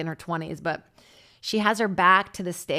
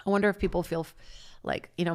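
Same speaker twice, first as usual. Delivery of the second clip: ordinary talk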